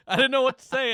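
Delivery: high laugh through the words. Real, not acted. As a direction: break up laughing as you talk. Laughing at creep's question.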